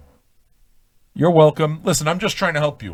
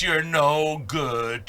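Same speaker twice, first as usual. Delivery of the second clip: slow drawn-out taunt; 4 dB quieter, much slower than usual. Pretend. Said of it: "You gotta tell him".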